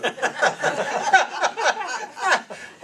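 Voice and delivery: long high burst of laughter; real high laugh, falling away; big laugh. Real, not acted. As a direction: crack up at the memory. Laughs at his own story.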